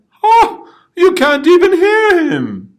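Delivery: loud gloating ha; mocking laugh. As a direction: crow over the old model. Mocking the old version.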